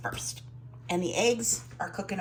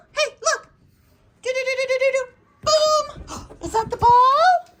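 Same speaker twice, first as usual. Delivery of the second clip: pet talk; 9 dB louder than usual. Real, not acted. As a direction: gasp and coo at the pug. Playing ball with the pug.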